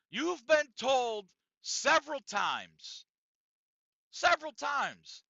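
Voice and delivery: high, loud, slow scolding; Bob laying into him. Retold or imitated. Bob lighting into Sauna Santa, imitated scolding. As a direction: act out a stern telling-off, stretching the words. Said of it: "he lit into Sauna Santa".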